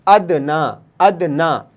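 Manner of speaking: अदना said as ad-na with the alif sound; model pronunciation. Shown as a demonstration. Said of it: "ये की आवाज़ ख़त्म हो गई और अलिफ की आवाज़ आ गई".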